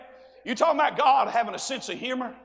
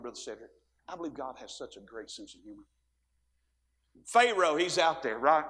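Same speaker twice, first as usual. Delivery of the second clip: ordinary talk, nothing marked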